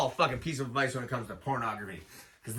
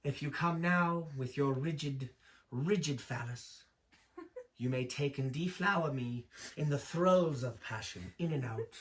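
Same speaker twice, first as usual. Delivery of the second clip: imagined British voice; 5 dB quieter than usual. Acted. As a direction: recite it gently in a put-on British accent. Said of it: "like a British kind of a, a British voice".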